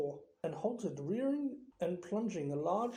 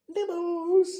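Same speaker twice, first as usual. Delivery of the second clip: sickly sweet squeal, much higher and louder; sweetly; 11 semitones higher, 9 dB louder than usual. Acted. Peeves offering fungus peanuts, mock sweet. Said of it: "he said sweetly".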